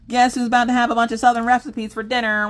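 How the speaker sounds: high, loud cackling taunt; gleeful mock threat. Mom taunting picky kids, high mock-villain glee. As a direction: gloat like a playful villain.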